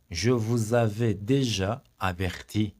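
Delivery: very slow, stretched déjà; slow model sentence. Shown as a demonstration. Teacher slowly repeats the warning sentence for students. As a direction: draw the sentence out word by word.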